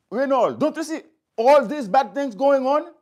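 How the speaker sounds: demanding he see it, voice up; retold angry plea to best friend Renold; angry protest. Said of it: "I was so angry".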